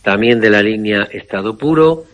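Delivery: ordinary delivery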